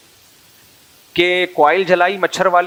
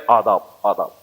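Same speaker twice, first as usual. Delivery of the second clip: low, lightly voiced mock-courtly آداب, آداب; bow and greet like a very polite newborn; courtly baby greeting; 7 semitones lower than usual. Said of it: "وہ ایسا style مار رہا تھا". (نومولود بچے کا مزاحیہ مؤدبانہ آداب)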